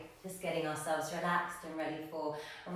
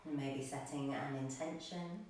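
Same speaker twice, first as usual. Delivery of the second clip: guided meditation; 2 semitones lower, 3 dB quieter than usual. Real, not acted. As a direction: settle them gently into stillness, breath by breath. Leads breathing and settling meditation.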